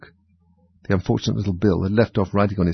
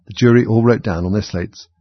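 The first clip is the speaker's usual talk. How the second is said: plain reading voice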